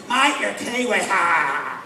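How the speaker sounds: heckling bloke. Retold or imitated. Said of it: "one bloke leaps to his feet".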